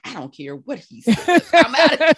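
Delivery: high, loud, laughing outburst; mock-defiant declaration, voice shooting up; laughing defiance. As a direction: blurt it out, breaking into laughter. Retelling her defiant decision, laughing.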